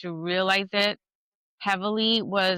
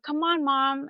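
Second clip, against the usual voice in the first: coaxing call; 7 semitones higher than usual. Retold or imitated. Calling mom to the photo.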